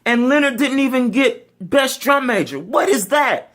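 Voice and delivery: high, loud indignant friend; indignant guard member. Retold or imitated. Color guard member complaining to instructor, high outraged squeal. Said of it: "she's just like".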